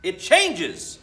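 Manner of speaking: loud eager exclamation; excited exclamation. A narrator's reading. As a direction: blurt out the answer eagerly. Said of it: "exclaimed Trudy".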